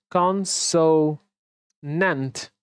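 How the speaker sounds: "Consonant" drawn out in separated syllables; slow syllable by syllable. Shown as a demonstration. Teacher sounding out the word, very slowly.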